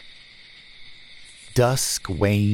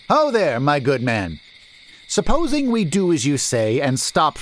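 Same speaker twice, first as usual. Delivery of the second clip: higher, bold merchant voice; hailing merchant; 6 semitones higher than usual. A narrator's reading. Merchant hailing the stranger and refusing to stop, bold. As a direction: confront him firmly.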